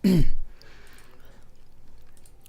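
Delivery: rough raspy throat clearing; harsh throat clearing. Real, not acted. Throat trouble while coding.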